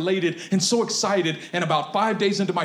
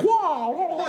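much higher mock Cantonese whoa; imitating a pastor's exclamation; rough exclamation; 12 semitones higher than usual. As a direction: yelp it like the pastors. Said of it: "that real rough".

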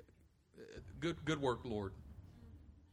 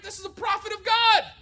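much higher, louder preaching shout; 14 semitones higher, 10 dB louder than usual. Real, not acted. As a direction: shout it to wake the room.

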